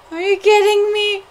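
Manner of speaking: loud, high outburst of disbelief; exasperated shout. Real, not acted. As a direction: yell it in exasperation.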